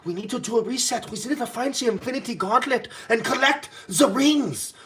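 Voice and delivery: imitating Schwab as Thanos, rising hammed-up; mock Thanos villain. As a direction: play a half-hearted Thanos scheming. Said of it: "I'm like".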